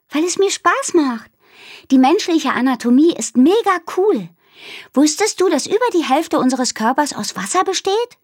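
put-on a brainy little girl showing off facts; Minnie, know-it-all. Minnie lecturing Aru about her tummy, chirpy know-it-all.

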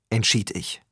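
usual speaking voice

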